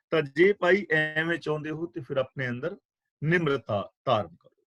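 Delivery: normal talking voice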